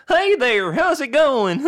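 high, loud cartoon character; too cartoony. Shown as a demonstration. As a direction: hail them in an exaggerated cartoon falsetto. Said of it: "that's too cartoony".

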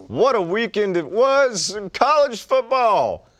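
big broadcast announcer opening. Pretend. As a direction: boom it out like a TV host. Announcer-style intro to segment.

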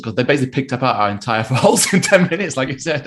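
ordinary delivery

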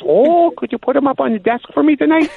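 high put-on caller request; the weird caller asking a favor sweetly; prank caller voice. Caller asks the sportscaster to put boots on the desk, high mock voice. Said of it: "He goes".

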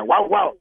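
yappy high-pitched barking; high dog bark. Acted. Dog barks in joke.

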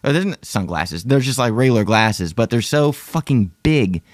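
usual speaking voice

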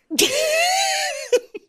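high laugh. Real, not acted. Hosts joking about wealth.

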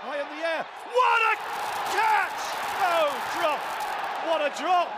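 high, loud shriek of a call; excited shout. Real, not acted. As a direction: scream the near catch in excitement. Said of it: "I'm so sorry, listeners, if it's freaked you out".

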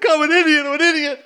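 high-pitched whining self-blame; mock self-reproach. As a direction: imitate someone wailing at his own stupidity. Acts out character berating himself.

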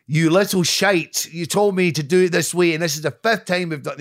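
imagined rant at a director; angry telling-off. Retold or imitated. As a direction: get in his face and let him have it. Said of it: "get in his face and say".

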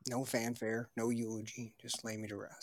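hushed dying request. Pretend. Voicing dying Teddy's wish.

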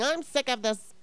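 lightly whiny, put-upon mock voice; a mild sarcastic whine. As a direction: imitate an entitled complainer, only lightly. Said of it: "being so entitled and whining and going".

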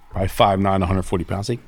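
ordinary talk, nothing marked

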